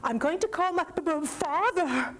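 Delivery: put-on stammering, high frightened boy; shaky voice. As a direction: stutter over the word nervously. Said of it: "Tommy said in a shaky voice".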